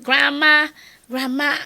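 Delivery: calling out. Retold or imitated. Children calling through silent house.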